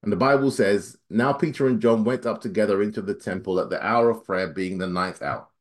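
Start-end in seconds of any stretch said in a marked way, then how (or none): 1.1-5.5 rushed scripture reading, fast. Real, not acted. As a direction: hurry through the verse. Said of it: "I'll read as fast as I can".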